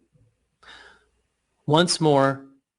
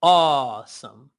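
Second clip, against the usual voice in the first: awesome stretched slowly, aw held long; slow elongated awesome; slow drawn awesome; 3 dB louder, slower than usual. Shown as a demonstration. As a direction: draw out awesome. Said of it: "Say awesome".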